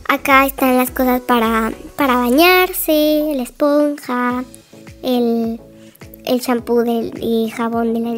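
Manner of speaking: usual speaking voice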